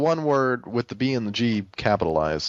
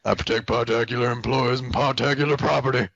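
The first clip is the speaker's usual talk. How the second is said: mock yelling character. Doing a listener's quote on request, mock yell. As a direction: deliver it as a hammed-up declaration. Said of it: "who is yelling".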